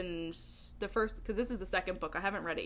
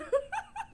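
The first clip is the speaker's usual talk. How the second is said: bright burst of giggles; a quick high laugh. Real, not acted. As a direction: giggle at your own joke. Laughing at own joke.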